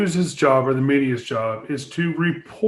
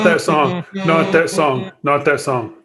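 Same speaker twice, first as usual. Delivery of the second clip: louder, pleading not that song; loud protest; 4 dB louder than usual. Acted. Co-host loudly protests against singing that song again.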